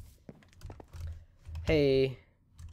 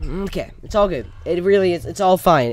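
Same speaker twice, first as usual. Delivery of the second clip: forced cheer; 4 semitones higher, 8 dB louder than usual. Acted. Forcing calm after failing.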